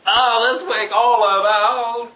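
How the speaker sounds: loud, slow announcer welcome; show host opening. Acted. Host opening the show, loud slow welcome. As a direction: greet the audience like a show host.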